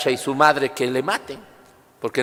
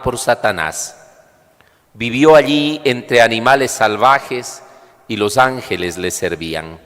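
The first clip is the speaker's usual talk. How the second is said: measured, solemn scripture reading; Gospel reading; 4 dB louder than usual. Real, not acted. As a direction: proclaim it like the Gospel at Mass. Priest proclaims the Gospel reading.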